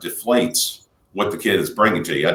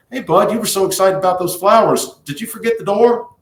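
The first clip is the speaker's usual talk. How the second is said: higher, kind prompting question; lightly voiced gentle parent; 10 semitones higher than usual. Shown as a demonstration. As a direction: nudge the kid gently about the door. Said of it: "you look at them ... and say".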